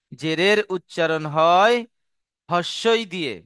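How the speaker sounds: slow, with হয় left hanging before the answer; slow dictation. Shown as a demonstration. Teacher dictating the zer rule to students, slow and broken in two. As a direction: draw out হয়, pause, then হস্যই দিয়ে.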